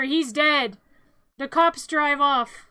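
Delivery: usual speaking voice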